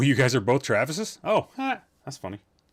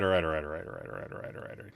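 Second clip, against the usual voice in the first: fading to whisper; 7 semitones lower, 8 dB quieter, much faster than usual. Pretend. Fading chant of all right.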